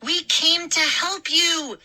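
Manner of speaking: scream. A narrator's reading. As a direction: scream it at the mob. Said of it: "I heard Mindy scream".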